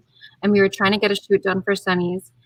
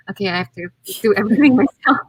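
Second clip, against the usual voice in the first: exasperated; 4 dB louder, faster than usual. Retold or imitated. Exasperated wife quoting herself.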